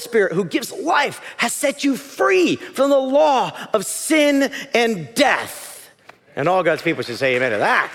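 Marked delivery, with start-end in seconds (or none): 0.0-5.9 high-pitched, slow scripture proclamation; ringing, drawn-out preacher's proclamation; preaching declamation. Real, not acted. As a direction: proclaim it to the whole congregation. Declaims scripture at full force.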